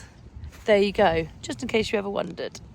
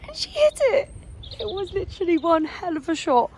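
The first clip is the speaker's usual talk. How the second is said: excited praise; 10 semitones higher than usual. Real, not acted. Cheering a great shot.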